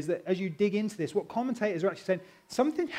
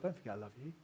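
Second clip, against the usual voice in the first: tender; 6 semitones lower, 13 dB quieter, much faster than usual. Retold or imitated. God's gentle voice retold.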